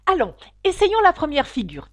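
loud, high rallying call; rally your partner to start; eager urging. A narrator's reading. Fausse tortue lance la danse. (lancer la danse avec entrain)

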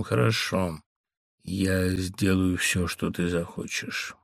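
slow, quiet tired surrender; weary voice. A narrator's reading. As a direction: give in, drained and worn out. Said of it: "ответила усталым голосом". (усталый, покорный голос жены)

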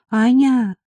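high, ailing voice; sick voice. A narrator's reading. Marika phones her friend for help, sick voice. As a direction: call out as if feeling poorly. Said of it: "сказала Марийка больным голосом".

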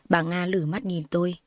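ordinary delivery, nothing marked

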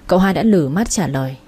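ordinary delivery, nothing marked

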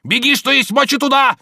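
very high, loud urgent yell; shouting after someone. A narrator's reading. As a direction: shout orders after her as she runs. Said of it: "кричал он ей вслед". (крича вслед)

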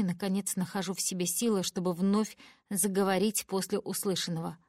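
ordinary delivery

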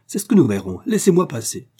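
high, loud demand; stamping, furious. A narrator's reading. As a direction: Aurora stamps her feet, shouting at Olivier. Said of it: "en trépignant".

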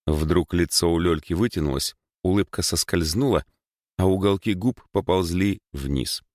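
plain reading voice

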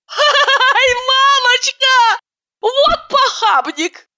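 squealing with laughter. A narrator's reading. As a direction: squeal as a husband pinches her. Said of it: "неожиданно взвизгнула и с хохотом закричала".

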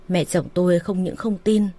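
usual speaking voice

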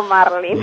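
"marlin" stressed on MAR, English-style; stress on first syllable. Shown as a demonstration. Expert conceding the common usage, front-stressed "marlin". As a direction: hit MAR, leave "lin" unstressed in "marlin". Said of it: "la pronunciación difundida es marlin".

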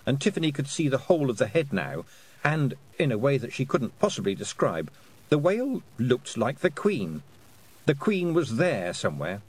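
ordinary delivery

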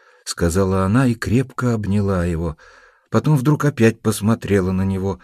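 ordinary delivery

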